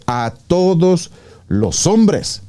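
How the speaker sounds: "A todos los hombres" said slowly, each word weighted; slow emphatic stress. Real, not acted. Preacher driving home the verse to listeners, slow and emphatic.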